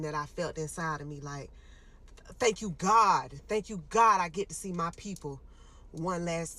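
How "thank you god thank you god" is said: grateful cry to God. Retold or imitated. Relief prayer on seeing parents.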